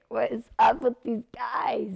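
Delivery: high, loud, baffled exclamation; incredulous. Retold or imitated. Actress and co-star puzzled by castmates, loud incredulity. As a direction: say it in exasperated disbelief. Acts bewildered reaction with co-star.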